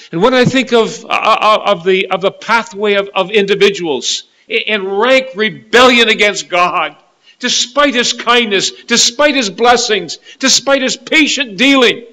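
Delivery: high, loud, insistent pulpit delivery; impassioned preaching. Real, not acted. Preacher decrying rebellion against God, impassioned.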